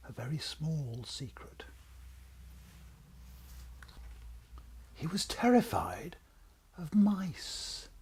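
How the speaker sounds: hushed confiding whisper; secret whisper. A narrator's reading. Narrator confides dragon's secret.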